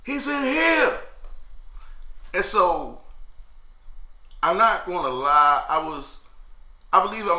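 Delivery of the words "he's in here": high mock yell of the sergeant; retold scream. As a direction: call it out high and sharp like a guard. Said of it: "I hear the sergeant scream out".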